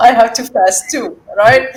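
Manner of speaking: said through real laughter, high and loud; laughing. Real, not acted. Sharing the joke with the listener, laughing through the words. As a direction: push the words out mid-laugh.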